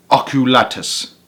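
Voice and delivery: plain reading voice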